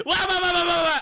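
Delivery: high, loud wah-wah yapping; own loud squawk. Retold or imitated. Showing the duo's classic dynamic, high loud squawk. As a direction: yap it high and loud, caricaturing yourself. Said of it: "'cause I'm like".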